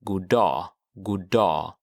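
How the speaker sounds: god dag run together as "goodah", with the g dropped; teacher shows the natural blended greeting. Shown as a demonstration. Teacher demonstrates the everyday god dag. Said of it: "sort of combined".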